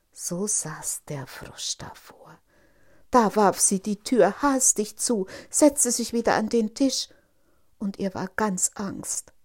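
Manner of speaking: usual speaking voice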